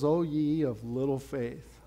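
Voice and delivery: a tired master sighing at them; gentle rebuke. Acted. Preacher voices Jesus rebuking disciples.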